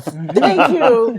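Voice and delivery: thanks through a laugh; giggling thank you. Real, not acted. Laughing at the Jonathans nickname.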